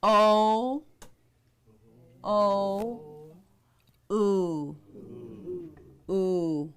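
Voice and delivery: "O, O, oo, oo" as separate held vowels; demonstrated vowel sounds. Shown as a demonstration. Teacher showing the U's two sounds, held "O, O, oo, oo". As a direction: sound out "O" twice, then "oo" twice, drawn out. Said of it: "also makes two sounds".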